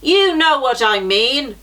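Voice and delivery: a giant booming in anger; angry giant. A narrator's reading. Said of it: "answered the giant angrily".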